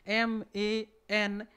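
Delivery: ᱮᱢ ᱮ ᱮᱱ spelled out letter by letter, very slowly; drawn-out spelling of ᱮᱢ ᱮ ᱮᱱ. Shown as a demonstration. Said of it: "ᱢ ᱨᱮᱭᱟᱜ ᱩᱪᱪᱟᱨᱚᱱ ᱦᱩᱭᱱᱟ".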